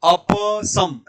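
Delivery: अप with a full open प, then सम; correct अप सम. Shown as a demonstration. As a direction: say अप as a-pa, apart from सम. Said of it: "ऐसे बोलना है". (अप के प का पूरा उच्चारण, सम अलग)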